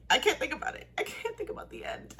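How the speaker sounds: high, breathless whispered whine; mock despair, half whispered; mock dread. Real, not acted. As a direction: squeak it out like you can't bear it. Dreading the finale ending.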